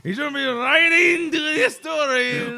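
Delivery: high, loud blended Japanese-Scottish accent. Shown as a demonstration. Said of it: "Japanese Scottish".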